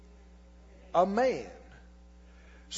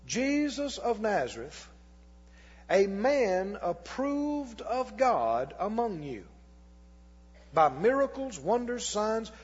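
scripture reading. Acted. Rereads verse, slower, deliberate.

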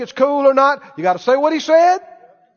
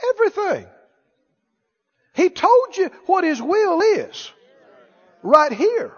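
ordinary talk

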